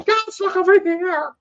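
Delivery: high-pitched, loud hollering; mock paparazzi scream. Host imitating paparazzi after a fishing guide, high mock scream. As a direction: shriek it like a pushy photographer. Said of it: "you know, screaming".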